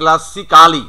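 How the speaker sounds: classically slowly as "kla-si-KA-li"; local mispronunciation. Shown as a demonstration. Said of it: "not classically".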